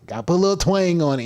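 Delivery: playful demo of a twang; put-on twang. Shown as a demonstration. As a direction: lift it up and lay on the twang.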